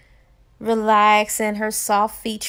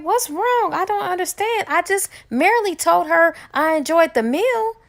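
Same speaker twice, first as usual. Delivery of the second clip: confused protest; 7 semitones higher than usual. Acted.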